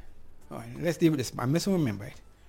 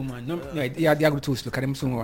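ordinary talk, nothing marked

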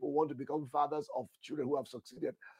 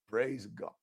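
ordinary talk

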